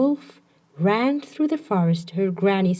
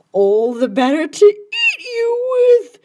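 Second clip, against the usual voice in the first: much higher, louder wolf lunging; wolf's cry; 10 semitones higher, 7 dB louder than usual. Acted. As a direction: roar it out as the wolf pounces. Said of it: "cried the wolf".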